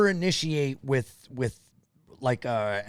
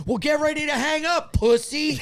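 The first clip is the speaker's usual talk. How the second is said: much higher, taunting retort; mock defiant snap; 13 semitones higher than usual. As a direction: fling the insult back. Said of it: "And I go".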